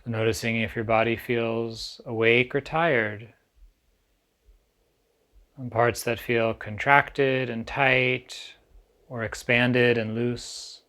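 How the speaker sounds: calm, unhurried meditation guide with long pauses; guided meditation. Acted. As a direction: ease listeners gently into stillness, leaving space between phrases.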